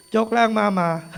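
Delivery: laughing it off toward mom; real laugh through the words. Real, not acted.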